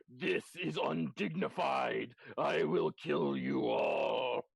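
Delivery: slow, loud creature threat; booming pie monster. Acted. Monster pie threatens the adventurers, booming and indignant. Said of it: "the pie's front just starts opening and saying".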